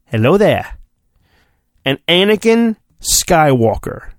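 ordinary delivery

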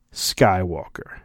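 ordinary delivery

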